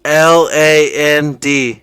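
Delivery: slow, loud letter by letter; spelling it out. Real, not acted. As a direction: spell each letter clearly.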